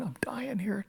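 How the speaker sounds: hushed, whispered realisation; regretful whisper. Retold or imitated. Dying man facing his last day, whispered regret. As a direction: whisper it as if to himself. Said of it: "with the regret of a lifetime".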